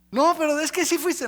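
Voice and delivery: accusing. Acted. Discusión actuada entre mentiroso y acusador. (acusando en voz alta y aguda)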